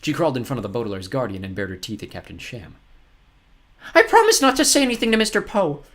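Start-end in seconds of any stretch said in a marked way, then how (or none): 3.9-5.8 high, loud panicky woman; desperate. A narrator's reading. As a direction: bargain desperately, pitched high. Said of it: "Aunt Josephine said desperately".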